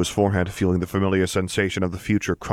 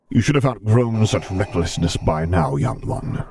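a mild snarl, louder; Vader's cold reprimand, understated growl; 3 semitones higher, 3 dB louder than usual. A narrator's reading. Vader scolding his reckless son, mild snarl. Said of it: "he snarled".

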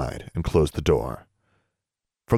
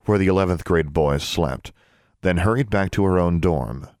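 ordinary delivery, nothing marked

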